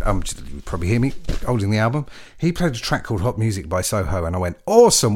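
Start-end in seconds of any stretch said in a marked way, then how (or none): 4.6-5.1 loud, high burst of delight; high-pitched, impressed outburst; excited reaction. Retold or imitated. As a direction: exclaim it like a thrilled fan. Retells his delighted first reaction.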